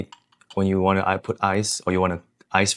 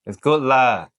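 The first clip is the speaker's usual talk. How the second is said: "It's good, lah" with a punchy Singaporean lah, raised; Singlish "lah", higher and louder; 4 semitones higher, 6 dB louder, slower than usual. Shown as a demonstration. Co-host copies the Singlish phrase, Singaporean accent. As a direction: sell the Singlish lah with gusto. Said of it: "Stay shocked, lah".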